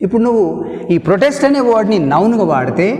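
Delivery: ordinary talk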